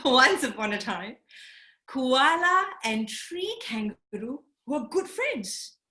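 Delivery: ordinary delivery, nothing marked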